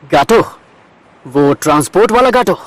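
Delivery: surprised questioning, lifting at the name; startled disbelief. Acted. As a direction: echo the name as if taken aback.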